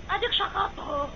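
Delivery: quiet triumph. Acted. Wife quietly gloating that her husband shouted. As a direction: drop your voice, you caught him.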